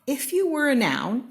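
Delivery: high, loud announcing refrain; bright proclaiming refrain; excited refrain. A narrator's reading. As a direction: call it out like a title.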